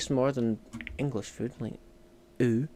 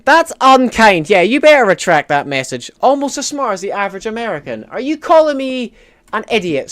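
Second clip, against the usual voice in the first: loud, high, hammed-up indignation; mock outrage; 6 semitones higher, 9 dB louder than usual. Streamer answers viewer's insult about being smart, mock outrage. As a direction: protest loudly as if deeply insulted.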